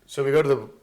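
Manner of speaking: ordinary talk, nothing marked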